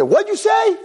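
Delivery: indignant retort. Retold or imitated. Howie shocked at being told he's going to hell. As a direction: squeal it in disbelief.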